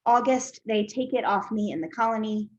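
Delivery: measured recital, pauses between phrases; poem reading. Acted. Poet reads an imagined patient's poem, measured recital. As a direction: set out each line deliberately, letting silences sit.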